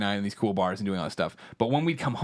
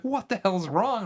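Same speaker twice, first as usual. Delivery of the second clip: alarmed wife; 8 semitones higher than usual. Retold or imitated. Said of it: "Christine's like".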